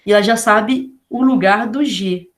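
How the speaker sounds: normal talking voice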